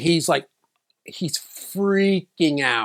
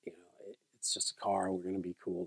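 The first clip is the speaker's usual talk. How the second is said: reassuring dad; 6 semitones lower, 11 dB quieter than usual. Retold or imitated. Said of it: "He's like".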